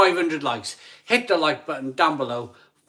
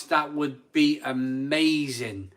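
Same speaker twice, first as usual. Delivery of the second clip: normal talking voice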